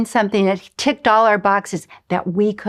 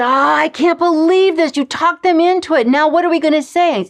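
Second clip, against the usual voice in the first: higher, louder, exasperated disbelief; husband throwing up his hands, higher and louder; 8 semitones higher, 5 dB louder than usual. Retold or imitated. Husband upset about withdrawing.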